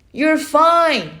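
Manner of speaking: loud, high reassuring shout. Acted. Uncle shouts across the river to the boy, shouted. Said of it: "he shouted".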